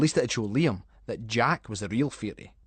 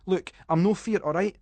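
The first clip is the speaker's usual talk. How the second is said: defensive protest; 7 semitones higher, 3 dB louder than usual. Acted. Callum protesting he's not scared.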